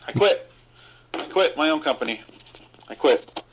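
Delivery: mock quitting. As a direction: slam it down like a fake resignation. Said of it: "mock quitting rant".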